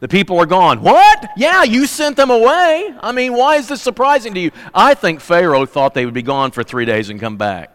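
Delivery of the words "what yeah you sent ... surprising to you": a shocked king caught off guard; startled disbelief. Retold or imitated. Pharaoh hears people are gone.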